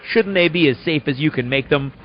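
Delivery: normal talking voice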